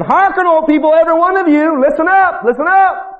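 high, loud proclamation; a prophet's loud cry; calling out. Acted. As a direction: call the crowd to attention. Micaiah calls out to people.

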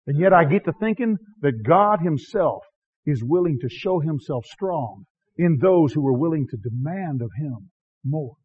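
usual speaking voice

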